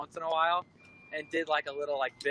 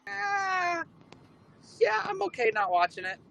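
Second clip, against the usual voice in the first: polite brush-off. Retold or imitated. Feigning polite disinterest in Simpsons.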